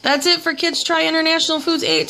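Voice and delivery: upbeat sign-off to viewers; cheerful channel outro; vlog outro. Acted.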